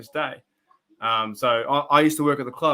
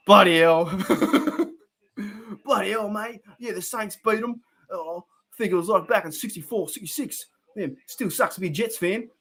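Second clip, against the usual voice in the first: higher, broad Australian imitation of a mate; Glenn's Aussie voice; 6 semitones higher than usual. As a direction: throw on a big Aussie bloke accent, higher. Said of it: "That's Glenn's voice".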